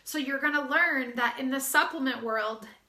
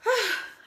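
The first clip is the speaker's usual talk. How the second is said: big breathless sigh, high then falling; heavy sigh; 9 semitones higher, 4 dB louder than usual. Real, not acted. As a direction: let out a long tired exhale. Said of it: "I'm really out of breath today".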